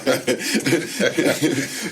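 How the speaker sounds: spontaneous burst of laughter; real laugh. Real, not acted. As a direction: laugh along at the joke. Host laughs at guest's joke.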